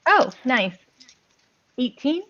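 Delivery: ordinary delivery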